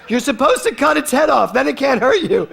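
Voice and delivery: high, loud laughing explanation. Retold or imitated. Explaining to Kevin how to kill snakes, laughing loudly. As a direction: keep laughing as you explain.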